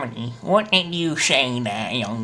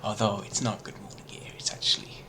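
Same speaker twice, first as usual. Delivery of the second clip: soft breathy whisper; 5 semitones lower, 14 dB quieter, much faster than usual. Pretend. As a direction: drop to a hush. Whispered repeat.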